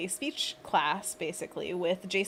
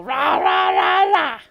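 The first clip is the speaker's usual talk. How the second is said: much louder, higher cartoon monster rah; monster growl; 8 semitones higher, 14 dB louder, slower than usual. Shown as a demonstration. Streamer demonstrating the monkey paws stretch, loud monster roar. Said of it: "and then we go".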